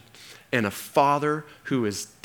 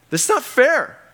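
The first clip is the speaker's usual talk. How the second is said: much higher, louder cry of "not fair"; mock outraged whine; 7 dB louder than usual. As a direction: wail "This is not fair" like a sulking teen. Same words, raised.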